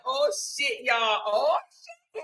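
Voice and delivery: high squealing surprise; thrilled by a donation; excited surprise. Real, not acted. Reacting to super chats.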